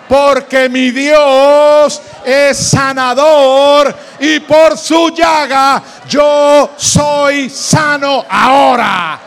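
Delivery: shouted, slow, high declaration of healing; preaching at full force. Acted. Preacher to congregation, repeat after me, full force. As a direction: proclaim it to the back row.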